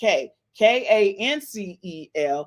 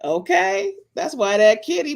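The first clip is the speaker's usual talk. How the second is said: usual speaking voice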